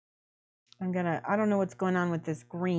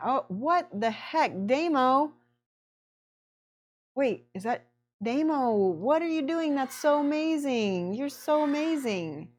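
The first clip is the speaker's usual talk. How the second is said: high-pitched, delighted disbelief; bowled over by a friend's generosity; amazed gratitude; 6 semitones higher than usual. Real, not acted. Reacting to big gifted subs.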